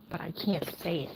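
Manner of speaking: whisper. Real, not acted. Whispered aside.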